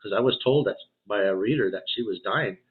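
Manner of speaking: ordinary delivery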